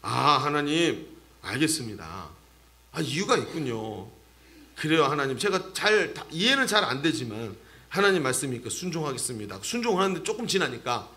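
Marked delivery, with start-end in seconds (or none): 0.0-9.6 low, softened prayer of surrender; acted meek, resigned obedience; humble acceptance. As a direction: give in gently to God. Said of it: "어떤 마음이 드냐면".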